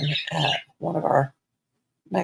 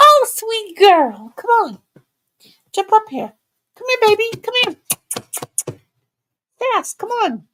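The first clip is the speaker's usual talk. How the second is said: high loud coaxing pet talk; high cooing call to the puppy; coaxing the dog; 14 semitones higher, 8 dB louder than usual. Real, not acted. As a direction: coax her up in a high sweet voice. Coaxing dogs onto the bed.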